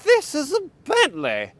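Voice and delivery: haughty, high and proud; snooty. Pretend. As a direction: say it like a snob looking down. Snobby repeat of Bentley line.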